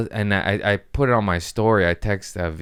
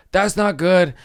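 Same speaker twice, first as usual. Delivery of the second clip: retold alarm; 11 semitones higher, 5 dB louder, slower than usual. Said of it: "I go".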